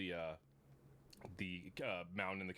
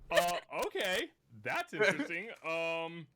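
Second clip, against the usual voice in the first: high, loud amused surprise; surprised laugh; 7 semitones higher, 9 dB louder, slower than usual. Real, not acted. GM caught off guard by the player, loud and amused. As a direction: react through the laugh. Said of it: "GM reacts laughing".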